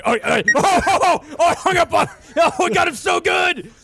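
high, loud gloating whoops; excited shout. Real, not acted. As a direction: crow with glee at the prank. Gloating after the prank.

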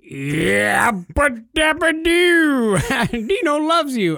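high, slow, loud cartoon caveman; Fred Flintstone impression. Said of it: "he said".

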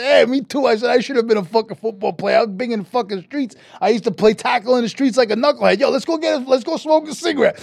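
high-pitched, excited bragging; brash boasting. Retold or imitated. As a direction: brag to a new buddy, all hyped up. Retold brash boasting to quarterback.

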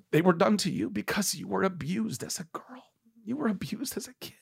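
soft tender whisper; a hushed confession of empathy. Real, not acted. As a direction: breathe it gently, almost unvoiced. Tender whispered forgiveness to mother.

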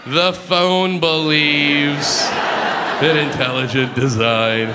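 very slow, drawn-out imitation of the clerk; mock clerk spelling out a reason slowly; slow dictation. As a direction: say it as you write it down word by word.

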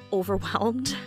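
ordinary delivery

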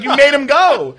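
high, loud disbelieving cry; incredulous. Retold or imitated. Son incredulous at mom, high loud cry. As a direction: shout it in disbelief. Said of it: "And I was like".

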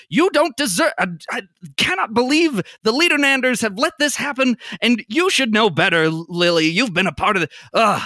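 jab the words like a pointing finger; an outraged director fuming at the table; angry ranting. Acted. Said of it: "angrily pointing".

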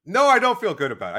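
loud, insistent no; emphatic denial. Real, not acted. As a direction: push back hard on the claim. Denying, louder first take.

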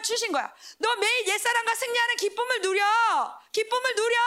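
high, ringing sermon climax; preaching at full force. Acted. Preacher urging the youth to win daily, full force. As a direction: proclaim it like a revival preacher.

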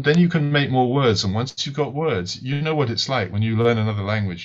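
ordinary delivery

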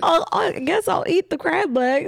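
laughing. Retold or imitated. Defending friend, laughing quote.

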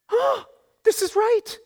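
high, slow wondering crowd voice; sudden realisation. Retold or imitated. Townspeople whose hearts have just changed, in a high slow wondering voice. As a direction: people seeing it at last, in a high slow voice. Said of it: "And they're like".

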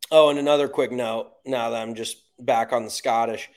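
normal talking voice

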